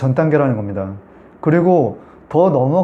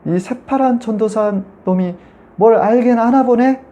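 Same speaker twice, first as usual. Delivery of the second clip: slow, higher taunting demon voice; mocking demon; 7 semitones higher, slower than usual. Demon in the woman taunting the young preacher, lightly voiced sneer. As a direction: jeer at the young preacher, drawn out.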